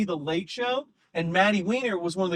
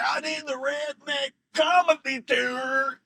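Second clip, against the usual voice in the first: put-on Southern accent; 4 semitones higher, slower than usual. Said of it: "he says it in a Southern accent".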